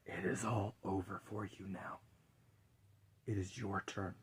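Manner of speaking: low, hushed whisper of Death. Acted. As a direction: breathe the words like Death in the dark. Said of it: "and said".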